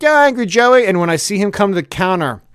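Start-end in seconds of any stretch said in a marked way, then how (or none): 0.0-0.8 high, loud chirpy hello; loud sing-song welcome; mock cheery greeting. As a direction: greet him with fake glee. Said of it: "I'm like".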